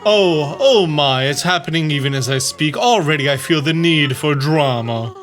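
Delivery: grand, theatrical diva voice; drag queen voice. Acted. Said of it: "that's my very marvelous, uh, drag queen voice".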